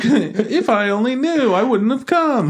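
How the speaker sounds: high, slow mocking lament; mock whiner. Moviegoer regretting showing up, mock whining. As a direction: drag out the fake regret way up high.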